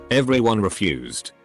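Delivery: ordinary delivery, nothing marked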